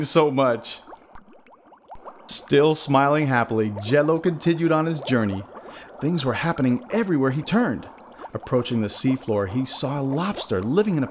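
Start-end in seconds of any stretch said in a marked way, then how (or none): none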